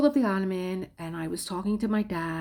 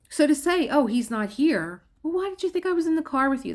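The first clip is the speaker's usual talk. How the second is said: high, loud, fast exasperated retort; heated retort; 9 semitones higher, 6 dB louder than usual. Retold or imitated. Wife retorting to her ex about the car, high and fast. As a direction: throw his words back at him. Retelling argument with ex.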